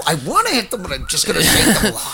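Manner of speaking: high, lightly voiced parent rationalizing; half-hearted frantic parent; mock frantic parent. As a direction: play the desperate parent talking themself down. Voicing a shaking parent.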